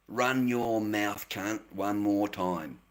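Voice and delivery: slow, drawn-out, low taunt; taunting dare. Real, not acted. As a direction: dare them slowly, stretching each word. Daring a chat troll to continue.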